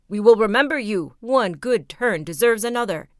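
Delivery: a loud, proud call of thanks; ant king calling out. A narrator's reading. Ant king calls after the departing youth, loudly. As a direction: shout the promise after him. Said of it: "the ant king cried out to him".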